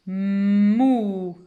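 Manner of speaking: "Mou" stretched loud into "mmmouuu"; blended syllable. Shown as a demonstration. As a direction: start "Mou" on a long hum, slide to the vowel. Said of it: "Je commence par le son de la première lettre". (« Mou » lu lié, sons prolongés)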